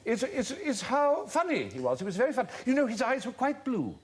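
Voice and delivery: high, fond society-lady impersonation; mock-wistful, high womanly voice; elderly woman's reminiscence. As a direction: pitch it right up, imitating a lady reminiscing. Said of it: "She said".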